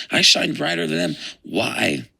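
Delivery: high, proud boast dropping to a demanding why; boastful grievance. Retold or imitated. Lucifer comparing himself to the stars, boasting bitterly. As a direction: protest that you outshine them all.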